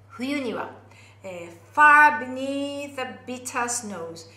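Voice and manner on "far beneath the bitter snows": far beneath the bitter snows, slow and loud on far; singing-teacher demo, slow and emphatic; slow stressed reading. Shown as a demonstration. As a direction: lean on far, stretch the line out. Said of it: "非常に厳しいね".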